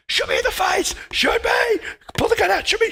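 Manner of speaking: psycho screams at the player to shoot him, high frantic shout; high-pitched, full-throttle crazed yelling; screaming. Acted. Random psycho begging to be shot, screaming nonstop. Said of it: "he just screams at you nonstop".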